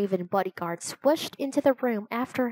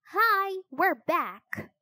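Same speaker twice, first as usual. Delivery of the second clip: high, cheerful call; 9 semitones higher, much slower than usual. A narrator's reading. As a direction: call out a high-pitched greeting. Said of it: "she called to the invisible children".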